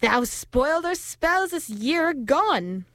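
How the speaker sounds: high, slow witch voice; yelling witches. A narrator's reading. Witches blaming the moon for spoiled spells, high drawn-out yell. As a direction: draw the accusation out high and slow. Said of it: "yelled the witches".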